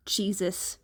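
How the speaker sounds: ordinary delivery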